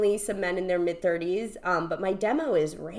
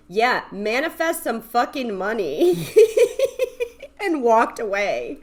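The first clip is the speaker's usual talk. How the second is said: higher, drawn-out retold taunt; brother's mocking jab; 4 semitones higher, 6 dB louder than usual. Brother mocking sister's manifesting, slow jeering retort. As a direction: imitate a brother's slow, dismissive dig. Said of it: "my brother here goes".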